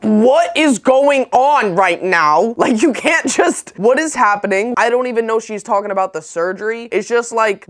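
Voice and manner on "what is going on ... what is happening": high, stretched-out bewildered protest; hammed-up, higher-pitched confusion; mock panic. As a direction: act out alarmed disbelief at an ominous summons. Acted alarm at mom's summons.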